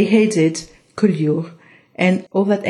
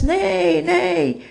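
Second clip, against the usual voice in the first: donkey bray; 10 semitones higher than usual. Acted. Donkey brays while teaching dance.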